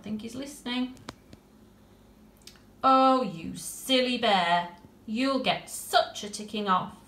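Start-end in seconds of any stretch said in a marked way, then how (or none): 2.8-7.0 put-on slow, louder, exasperated girl; fond scolding. Tilly scolds the silly bear, exasperated fondness.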